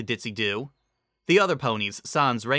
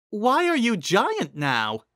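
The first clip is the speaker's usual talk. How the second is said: high, slow, puzzled pony voice; Cheerilee's voice; 10 semitones higher, 3 dB louder, slower than usual. A narrator's reading. Narrator voicing Cheerilee asking Raindrops why she is giant, high and slow. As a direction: ask it wide-eyed in a high voice.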